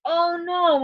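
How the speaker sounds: high and strong; scared cry. Shown as a demonstration. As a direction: rise up with power at the exclamation. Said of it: "a little bit more power to it".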